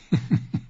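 short soft giggle; a fat maester's chuckle. Acted. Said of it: "kicherte er". (leises Kichern)

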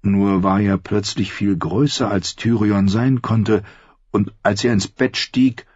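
ordinary talk

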